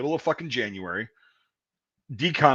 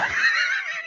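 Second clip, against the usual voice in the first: shrieking laugh. Real, not acted. Laughing hard at Dan's tasing story, shrieking laugh.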